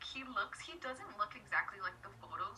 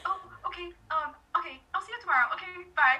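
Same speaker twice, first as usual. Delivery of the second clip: higher and louder, rushed, awkward goodbye; flustered goodbye; 6 semitones higher, 9 dB louder, faster than usual. Retold or imitated. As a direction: blurt out a flustered farewell. Awkwardly ending the chat with him.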